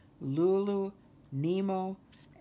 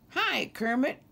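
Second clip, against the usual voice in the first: high, louder greeting; calling out; 9 semitones higher, 6 dB louder than usual. A narrator's reading. Nemo spots Kermit coming, calling out. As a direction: call out a hello. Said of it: "Nemo called out".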